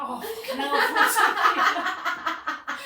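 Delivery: high, loud, drawn-out laughing wail; acted panic through laughter. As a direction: cry out in mock alarm while cracking up. Retold panic leading horse down steps.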